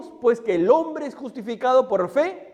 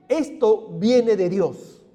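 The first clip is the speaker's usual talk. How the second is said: slow emphasis; much slower than usual. Real, not acted. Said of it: "slowed for emphasis".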